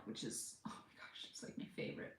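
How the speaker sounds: dropping to an unvoiced, awed whisper; a reverent whisper about her favorite burger; breathless whisper. Real, not acted. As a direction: hush it like a delicious secret. Gushing about In-N-Out.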